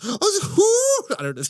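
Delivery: high wailing vampire gasp; a wild, high, drawn-out vampire yodel; vampire cry. Pretend. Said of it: "That's how vampires sound".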